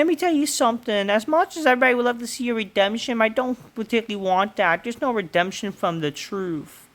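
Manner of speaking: high, fast put-on voice; mock female wrestler promo. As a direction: rattle it off in her high voice. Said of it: "it's like, you know".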